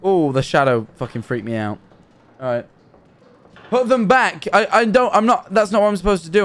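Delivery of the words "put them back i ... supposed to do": flustered. Real, not acted. Confused and spooked by the game.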